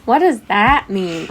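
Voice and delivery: slow, emphatic student question; imitating baffled students. Teacher retelling students' question, mock bafflement. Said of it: "They were like".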